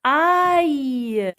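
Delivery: AÏE long and high, falling away; sound demonstration. Shown as a demonstration. Teacher repeats the sound for children, drawn out. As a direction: stretch AÏE into a gliding "a-y". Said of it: "Donc, A I deux L E".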